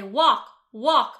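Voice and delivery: loud flat automated announcement; robot crossing voice. Retold or imitated. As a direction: imitate a talking crosswalk signal, loud. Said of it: "the automated voice will say".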